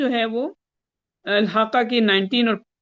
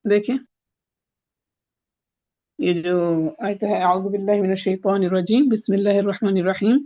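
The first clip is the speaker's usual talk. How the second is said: ordinary talk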